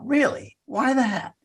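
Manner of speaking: very high, probing surprise; curious, slightly skeptical. Acted. Salesperson keeps the prospect talking, high and curious. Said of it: "When you're genuinely curious and sometimes skeptical".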